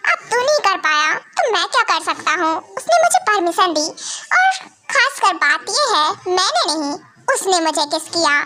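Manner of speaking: plain reading voice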